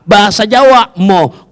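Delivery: normal talking voice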